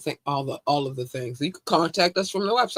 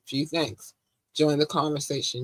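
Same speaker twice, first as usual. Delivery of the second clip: ordinary delivery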